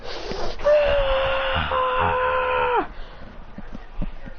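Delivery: long, high, strained scream; high sustained cry at contained volume; acted destructive scream. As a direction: scream while levelling a city. Beast roars destroying city.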